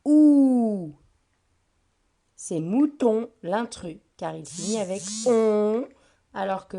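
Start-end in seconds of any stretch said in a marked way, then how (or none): 0.1-0.9 "ou" said alone, much louder and held; ending sound isolated. Shown as a demonstration. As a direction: sound out the final "ou" on its own. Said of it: "se terminent avec".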